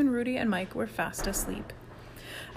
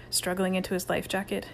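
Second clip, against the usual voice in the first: usual speaking voice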